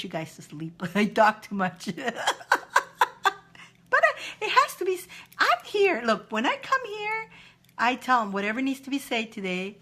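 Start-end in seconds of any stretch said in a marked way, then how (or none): none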